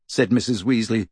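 plain reading voice

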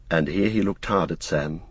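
ordinary delivery, nothing marked

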